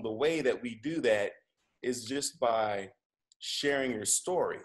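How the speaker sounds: ordinary talk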